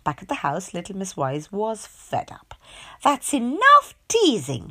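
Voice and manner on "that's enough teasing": slow, mildly cross complaint; a touch of annoyance. A narrator's reading. Miss Wise scolding her host, mildly cross. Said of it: "she said crossly".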